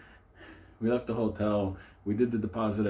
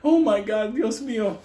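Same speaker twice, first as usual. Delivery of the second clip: high, loud mock gasp of horror; horrified housekeeper; 12 semitones higher, 6 dB louder than usual. Housekeeper walking in on the stabbing, mock horror. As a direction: imitate a shocked senora. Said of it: "She did one of those".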